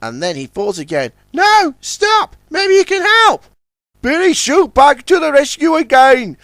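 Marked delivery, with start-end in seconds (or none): 1.3-3.4 very high, loud eager tug voice; bright busy little tug in a hurry; tug Ten Cents. Acted. Ten Cents talks with Puffer, high and urgent. 4.0-6.4 high, hearty boasting; jovial boast. Acted. Billy boasts to the tugs, hearty. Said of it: "laughed Billy".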